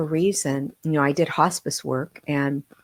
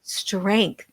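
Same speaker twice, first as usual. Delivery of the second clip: choked with emotion; 4 semitones higher, 5 dB louder than usual. Real, not acted. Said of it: "it's very emotional".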